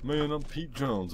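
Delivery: hamming up the Pete Jones persona; a touch of swagger; character voice. Acted. Gamer plays Pete Jones character.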